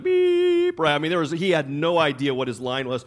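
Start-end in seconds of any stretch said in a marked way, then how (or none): 0.0-0.8 mock blank beep. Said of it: "He was like".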